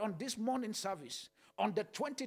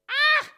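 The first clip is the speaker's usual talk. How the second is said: excited cry; 14 dB louder than usual. Real, not acted. Preacher outburst.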